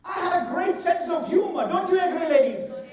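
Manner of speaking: loud, playful brag; mock boast. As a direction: show off to the ladies. Boasting to audience.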